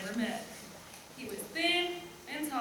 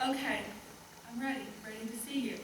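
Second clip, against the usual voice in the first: soft earnest lover; 3 semitones lower, 8 dB quieter, faster than usual. Acted. Lover's reply, voiced softly.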